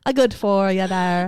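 slow, put-on Irish accent; mock Irish. Host praised for her accent, mock Irish. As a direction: drawl it out like an Irish pub regular. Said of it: "It's such a good accent".

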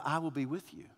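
a hushed, tender promise; quiet voice of God. Acted. God's voice, soft.

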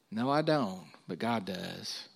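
usual speaking voice

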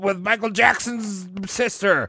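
hammed-up pop star addressing a crowd. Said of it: "when [name] was like".

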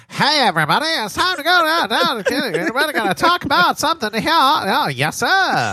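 put-on high, fast old-time radio announcer; old-timey voice. Shown as a demonstration. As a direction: belt it like a 1930s radio host. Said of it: "You mean this voice?".

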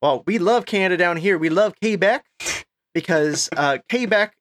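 high, drawling Texan client; Texas accent. Retold or imitated. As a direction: Texas accent, laughing through it. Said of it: "I'm gonna do my Texas accent".

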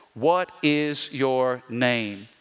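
very slow, measured command; slow demand. Acted. Jesus questioning the evil spirit, slow and commanding. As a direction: demand it word by weighted word.

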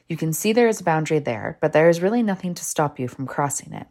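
usual speaking voice

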